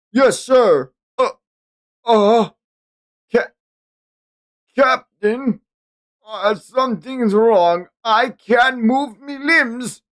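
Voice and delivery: high, slow, frightened; scared sailor. Acted. Sailor telling the captain he can't move, terrified. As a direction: stammer in growing fear.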